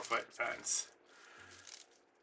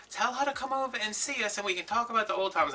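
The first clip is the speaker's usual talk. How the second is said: calling out; 9 semitones higher than usual. Retold or imitated. Neighbours call out invitation in story.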